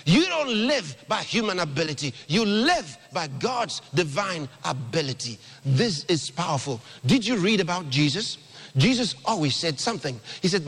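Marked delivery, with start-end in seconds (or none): none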